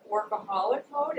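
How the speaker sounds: ordinary talk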